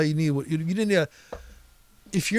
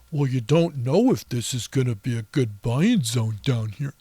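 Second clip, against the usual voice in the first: mocking voice; 5 semitones lower than usual. Mocking an imagined trader.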